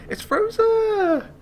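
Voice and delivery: high, slow, loud sing-song It's Rosa; greeting Rosa with a long happy call; drawn-out delighted call. Real, not acted. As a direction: stretch it out in joy. Repeats, calling out.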